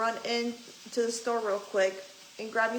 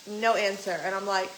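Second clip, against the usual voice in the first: ordinary delivery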